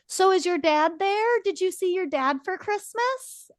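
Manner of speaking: lightly voiced grandmother fishing for gossip; imitating mom. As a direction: put on mom's nosy sweetness. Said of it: "my mom says to Cameron".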